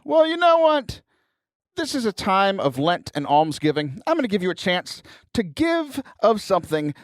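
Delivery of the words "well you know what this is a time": mock indignant. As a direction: playfully call out the lazy listener. Playful scolding of listeners.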